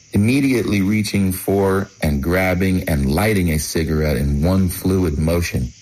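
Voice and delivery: ordinary delivery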